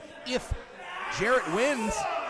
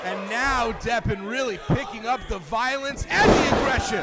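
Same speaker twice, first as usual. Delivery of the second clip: excited; 5 semitones higher, 7 dB louder than usual. Real, not acted. Action picks up.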